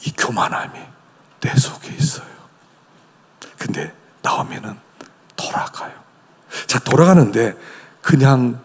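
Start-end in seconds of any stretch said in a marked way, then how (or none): 0.1-5.9 hushed whisper. Real, not acted. Preacher whispers to the congregation. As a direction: say it softly.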